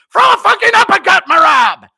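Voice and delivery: high, very loud cornerman scream; Matt Serra impression. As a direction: scream it across the arena at your fighter. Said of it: "out of nowhere I scream".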